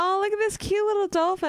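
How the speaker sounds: high cooing voice at a cute dolphin; cooing swimmer. Retold or imitated. Swimmer cooing at a cute dolphin, high and sweet. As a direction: coo over the little dolphin. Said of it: "You're like".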